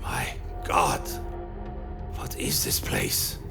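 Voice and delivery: hushed, breathless awe; whispering. Acted. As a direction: whisper it in dread. Narrator's character awed whisper.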